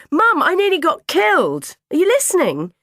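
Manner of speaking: put-on high, loud child demanding attention; aggrieved yelling at a parent; indignant shout. A narrator's reading. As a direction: shout to make mum listen. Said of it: "I shouted".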